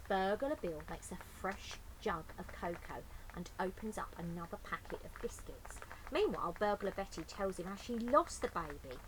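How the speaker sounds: usual speaking voice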